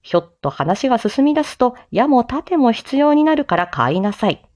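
ordinary talk, nothing marked

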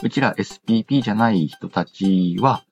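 usual speaking voice

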